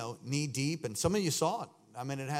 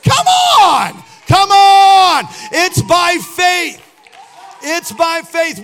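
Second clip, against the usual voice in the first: slow, loud, high shouted exhortation; fiery preacher stretching out by faith; preaching at full force; 22 dB louder, much slower than usual. Acted. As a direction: drive it home with each word.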